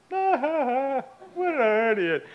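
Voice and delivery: very slow, high mocking cackle-talk; imitated laughing. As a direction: draw it out while guffawing at someone. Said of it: "and he's laughing".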